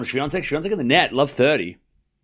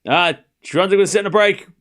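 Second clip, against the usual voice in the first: loud, high, re-energised score call; wide awake; 5 semitones higher, 5 dB louder than usual. Real, not acted. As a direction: announce it with a sudden jolt of energy. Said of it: "now I'm awake".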